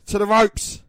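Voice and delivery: loud, forceful ringside urging; urgent shout. Real, not acted. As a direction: yell it to will the wrestler on. Urging repeated with more force.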